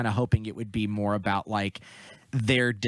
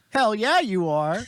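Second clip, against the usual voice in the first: high, loud cheer of approval; pitched-up, punchy hype; imitated cheering; 10 semitones higher, 5 dB louder, slower than usual. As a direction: egg them on with a whoop. Voicing a cheering audience reaction.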